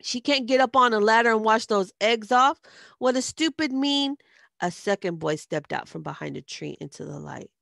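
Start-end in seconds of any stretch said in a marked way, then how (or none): 0.0-4.2 high, loud indignant outburst; angry scolding. A narrator's reading. Liz defending an old lady from egg throwers, angry yelling. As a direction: tear into him for being cruel.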